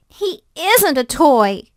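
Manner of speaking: put-on a child's upset protest; boy's protest. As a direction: insist he isn't a toy. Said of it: "the boy cried".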